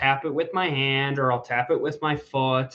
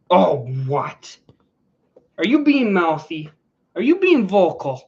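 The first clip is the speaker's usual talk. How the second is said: louder, higher mock-stern tease; mock scolding; 4 semitones higher, 6 dB louder than usual. Real, not acted. Owner mock-scolding her noisy dog, loud and high. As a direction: playfully tell the dog off for being mouthy.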